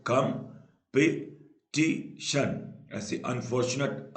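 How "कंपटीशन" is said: Competition very slow, in four separate beats; long gaps between the syllables of competition. Shown as a demonstration. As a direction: sound out competition part by part. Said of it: "चार syllable".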